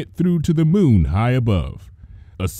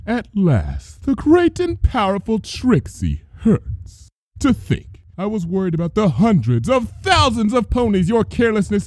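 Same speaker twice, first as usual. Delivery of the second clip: scornful princess; 8 semitones higher than usual. A narrator's reading. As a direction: pour cold scorn on her. Luna scorns Trixie.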